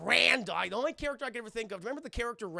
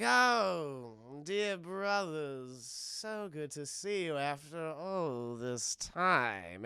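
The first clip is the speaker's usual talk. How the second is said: very slow, drawn-out villain greeting; sinister persona; 3 semitones lower, much slower than usual. Retold or imitated. Voicing changed Cold Stone to his brothers, slow eerie drawl. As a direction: stretch each word in mock-sweet menace.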